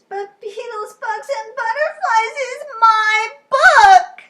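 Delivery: very high, loud, slow wail; claiming the book as hers; Lola wail. Acted. Lola wailing to Charlie, very high.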